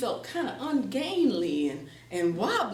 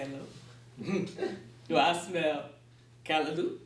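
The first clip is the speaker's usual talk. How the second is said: Anansi voice; 7 semitones lower, slower than usual. Acted. Anansi asks Antelope for food.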